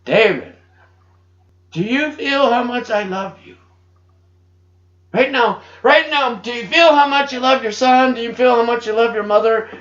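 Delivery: a loud, fervent divine voice; voice of Jesus. Retold or imitated. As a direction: speak as Jesus calling out in love. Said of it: "I hear the voice of Jesus Christ".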